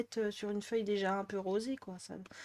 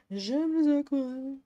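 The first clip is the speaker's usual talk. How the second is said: loud, high, gushing over the paints; over-the-top fangirling; gushing excitement; 5 semitones higher, 6 dB louder than usual. Real, not acted. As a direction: let the joy burst out, drawing out les aquarelles. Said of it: "faut que je me calme".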